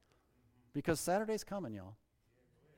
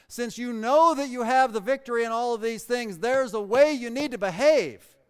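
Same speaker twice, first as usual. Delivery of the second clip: louder, higher building sermon; preaching at full force; 6 semitones higher, 8 dB louder than usual. Acted. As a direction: press the point on the listeners.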